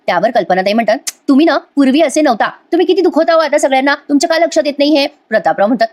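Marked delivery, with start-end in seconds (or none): 1.3-5.1 hurt complaint. Acted. Wife scolding husband in quarrel.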